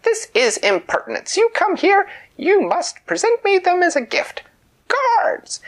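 very high, loud royal outrage; furious queen. Acted. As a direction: screech for the guards in rage. Queen outraged, calls guards.

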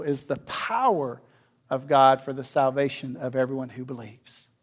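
hushed restatement; quiet reflective reading. Acted. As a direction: let the verse settle gently. Same verse repeated, quieter.

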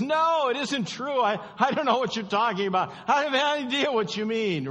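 high, loud, fast denial; Peter's denial. Acted. Peter denying Jesus to the servant girl, high and loud. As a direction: deny it in a panicked rush.

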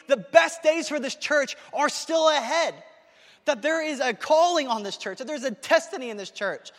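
high, urgent, excited rush; carried away with real excitement; excited preaching. Real, not acted. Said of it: "I'm just excited".

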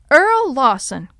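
loud, drawn-out reprimand; horrified scolding. A narrator's reading. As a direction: scold him sharply by his full name. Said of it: "Grandma scolded, horrified".

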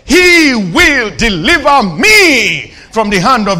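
loud, emphatic preacher's proclamation; ringing declaration of faith; bold declaration. Acted. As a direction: declaim it with force, leaning on the key words. Preacher declaims David's bold answer.

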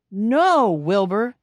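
loud telling-off; scolding. Acted. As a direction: scold the cat like stern ladies. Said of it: "they scold".

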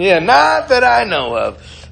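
high, loud, stretched-out dodge; used car salesman. Acted. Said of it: "used car salesmen".

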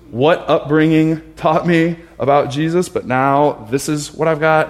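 imitated exasperated outburst; acted frustration. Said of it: "I'm so frustrated".

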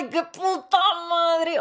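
grito desesperado, agudo y alargado; desperate yell. Retold or imitated. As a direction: suelta la rabia, lento y estirado. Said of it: "empiezo como a gritar de desesperación". (grito de frustración arrastrado)

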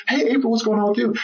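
high, quick friendly hello; excited greeting. Retold or imitated. First Lady greets a friend she knows, excited.

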